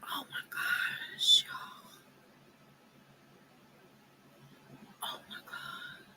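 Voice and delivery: slow, hushed, stunned whisper. Real, not acted. Reacting to the photo, a hushed stunned whisper. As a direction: barely breathe it out in shock.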